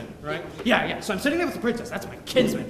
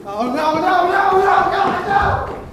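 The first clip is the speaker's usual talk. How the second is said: rising panic; 9 semitones higher, 10 dB louder, much slower than usual. Acted. Realizes plan failed, panics.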